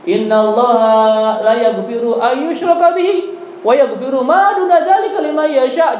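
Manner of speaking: slow recited Arabic verse; slow chanted Arabic; Quran recitation. Acted. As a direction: intone the verse slowly.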